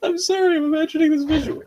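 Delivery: high laughing squeal; laughing hard. Real, not acted. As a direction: laugh through the words. Laugh-crying.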